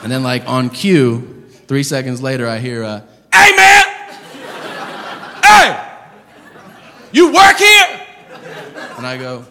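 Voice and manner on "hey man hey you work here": high, loud street holler; hammed-up hollering passerby; stranger shouting. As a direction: yell across the street at a stranger.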